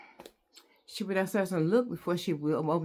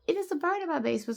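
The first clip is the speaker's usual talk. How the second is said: panicked; 12 semitones higher, much faster than usual. Retold or imitated. Panicked report of bird.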